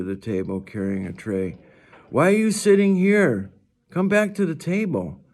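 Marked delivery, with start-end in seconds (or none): 2.1-5.2 put-on higher, louder girl calling her friend; other girl calling. A narrator's reading. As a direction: call her back to the table, a bit pushy. Another girl calls Summer back.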